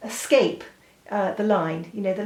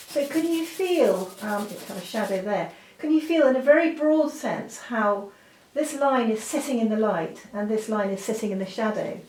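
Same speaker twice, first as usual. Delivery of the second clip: ordinary talk, nothing marked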